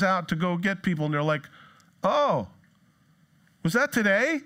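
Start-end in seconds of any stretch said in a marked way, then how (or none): 2.0-4.4 mock-innocent surprise, pitched high; feigned surprise. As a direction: act caught off guard, high questioning rise. Acting out guests making excuses.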